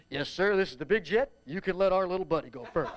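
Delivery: lightly put-on airline captain voice; deep airline pilot. Small-plane pilot fooling the tower, airline pilot voice. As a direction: play a big-jet pilot on the radio. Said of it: "in my deepest airline pilot voice".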